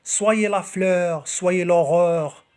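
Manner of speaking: slow, projected poetry reading; poem recitation. Acted. Reading Victor Hugo's poem to video viewers, slow declaimed recitation.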